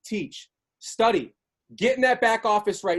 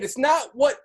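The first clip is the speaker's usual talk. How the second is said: ordinary talk, nothing marked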